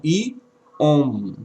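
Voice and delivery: "iom" stretched slowly as i-om; sounded-out syllable. Shown as a demonstration. Teacher adding the rest of the expression, "iom" drawn out slowly. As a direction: pull "iom" apart, holding the final m. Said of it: "Mas a expressão".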